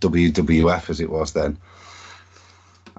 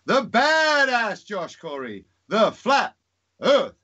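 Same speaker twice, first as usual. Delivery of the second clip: ring announcer; 12 semitones higher than usual. Acted. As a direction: belt it like a wrestling announcer. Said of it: "I'd do a ring call".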